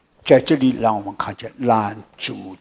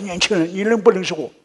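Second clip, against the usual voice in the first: excited greeting; 8 semitones higher, 6 dB louder than usual. Retold or imitated. Pastor excitedly greets youth.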